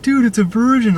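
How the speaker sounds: high-pitched imitation of a bully's call; mocking yell. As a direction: announce it mockingly to everyone around. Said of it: "yell out the stupidest things".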